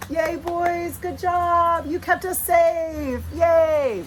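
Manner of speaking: high, slow, gushing praise; cheering the dogs. Real, not acted. Owner cheering dogs who scared the courier, high gushing praise.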